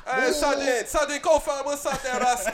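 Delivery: teasing imitation of Jamaican patois; Jamaican accent. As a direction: put on a playful Rasta accent. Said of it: "I'm about to do the accent".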